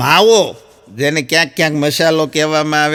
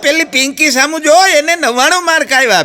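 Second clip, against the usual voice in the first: higher, louder mock scolding of a lazy son; a parent telling Pintu off, comic not fierce; 8 semitones higher, 3 dB louder than usual. Mother scolds Pintu over his single mark, in a mock angry raised voice. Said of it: "પિન્ટુને ખીજાણા".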